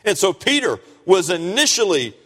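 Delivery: high, loud, emphatic pulpit delivery; full-force preaching. Real, not acted.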